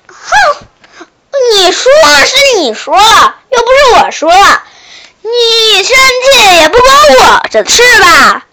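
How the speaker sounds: high, loud, sneering retort; bratty taunt. Acted. Shark taunting frog in a bratty way. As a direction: needle him like a spoiled brat. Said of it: "小鲨鱼非常刁蛮地说".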